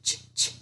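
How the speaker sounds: "ch" repeated as a voiceless burst; digraph sound. Shown as a demonstration. Teacher sounding "ch" for her class, voiceless. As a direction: tap out "ch, ch, ch" crisply. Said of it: "Which digraph says ch".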